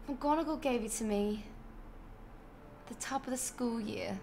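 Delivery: understated British accent; British accent attempt. Shown as a demonstration. Streamer practising film quotes, light British accent attempt. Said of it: "school in a British accent".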